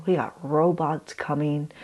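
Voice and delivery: ordinary delivery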